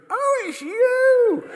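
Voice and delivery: very high, loud, drawn-out shriek; hammed-up delighted screech; loud phone greeting. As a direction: squeal it down the phone. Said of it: "and goes".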